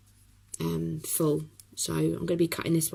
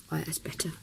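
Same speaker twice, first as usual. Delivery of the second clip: soft whispered aside to self; whispering; 8 dB quieter, faster than usual. Real, not acted. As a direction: whisper it under your breath. Quiet aside after sipping coffee.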